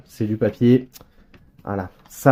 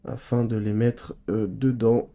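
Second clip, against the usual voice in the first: ordinary talk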